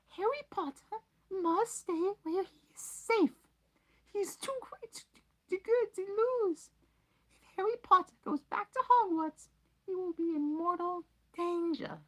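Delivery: very high squeaky elf voice, loud; high elf voice, hoarse and urgent; hoarse house-elf. Acted. Dobby's voice.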